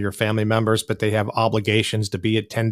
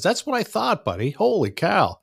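surprised; 9 semitones higher than usual. Real, not acted. Surprised it's 3 AM.

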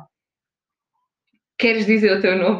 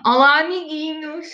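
bright squeaky sing-song welcome; 7 semitones higher, slower than usual. Acted. Proposing a greeting for listeners.

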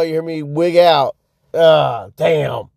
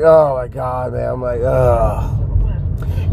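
low, mildly acted groaning; acting fed up in line; exasperated groan; 4 semitones lower, much slower than usual. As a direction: groan it under a sinking pitch. Acts his exasperation at the queue.

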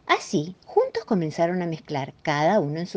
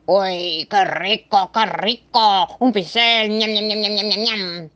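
louder, higher delighted munching monster; greedy monster; 3 semitones higher, 4 dB louder, slower than usual. Acted. Monster gobbling Mrs Moe's paintbrushes, greedy and delighted. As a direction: smack your lips like a gobbling beast.

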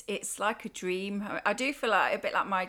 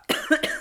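one harsh chesty cough; 8 semitones higher, 7 dB louder than usual. Real, not acted. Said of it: "sorry, I've got a cough".

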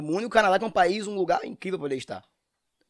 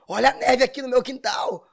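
higher and louder, showing off the snow; a show-off friend on a video call; mock excited caller; 9 semitones higher, 4 dB louder than usual. (exibido mostrando a neve, mais agudo e alto)

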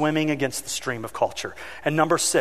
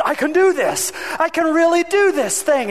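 put-on high, growing confident kid; child's voice; 12 semitones higher, 4 dB louder than usual. Child on the bike finding courage, high voice. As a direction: sound like a kid who just got it. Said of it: "They'd build confidence".

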